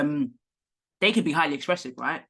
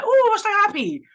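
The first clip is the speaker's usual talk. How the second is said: squealed way up high and louder; mock joy; 8 dB louder than usual. Imitating her mum's joy, pitched way up and loud. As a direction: squeal it like mum celebrating. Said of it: "it might just be like".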